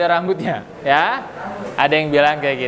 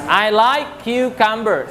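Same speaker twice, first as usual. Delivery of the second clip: slow model sentence; 3 semitones higher, slower than usual. Shown as a demonstration.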